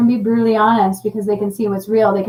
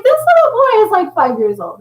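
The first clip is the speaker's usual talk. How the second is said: 1 amused disbelief; 10 semitones higher, 5 dB louder than usual. Retold or imitated. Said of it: "And I'm like".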